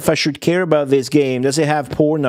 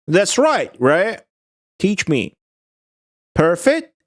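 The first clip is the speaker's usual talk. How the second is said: ordinary talk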